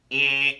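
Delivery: loud, drawn-out "eh" like a wrong-answer buzzer; buzzer noise. Retold or imitated. Speaker imitates the buzzer going off in their head, loud buzzer noise. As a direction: blare it like a game-show buzzer. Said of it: "like a whole buzzer go off".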